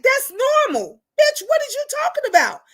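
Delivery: very high-pitched retold argument; exasperated retort. Setting her friend straight in a retold argument, exasperated.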